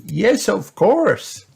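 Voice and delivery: high, slow granny voice; Granny Pig. A narrator's reading. Granny agreeing to her grandchild's request, high slow granny voice. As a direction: draw out the reply in a high voice. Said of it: "replied Granny Pig".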